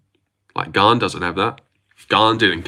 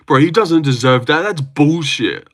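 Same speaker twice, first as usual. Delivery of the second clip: higher-pitched indignant disbelief; mock outrage; 6 semitones higher, 3 dB louder than usual. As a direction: imitate an outraged fan calling it unfair. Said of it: "anyone would talk shit like".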